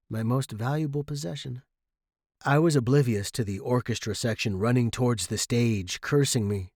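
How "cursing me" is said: usual speaking voice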